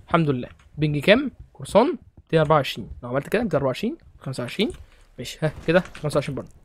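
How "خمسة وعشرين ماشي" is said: ordinary talk, nothing marked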